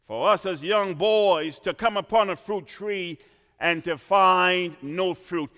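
ordinary delivery

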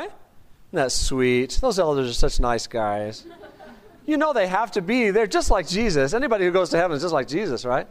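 ordinary delivery

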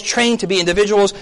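ordinary talk, nothing marked

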